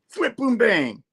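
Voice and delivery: high-pitched comic sound effects; mock fight noises. Narrating the mace fight, high mock impact noises.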